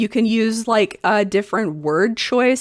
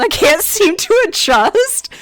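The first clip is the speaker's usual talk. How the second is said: laughing; 11 semitones higher, 4 dB louder than usual. Real, not acted. Reading Reddit post, laughing.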